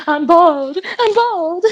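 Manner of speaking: high-pitched hammed-up wail, laugh creeping in; mock breakdown crying. Villainous mother-in-law losing her wig, mock crying breakdown. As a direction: imitate a high, distraught meltdown. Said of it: "breaks down crying".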